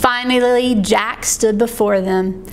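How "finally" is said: plain reading voice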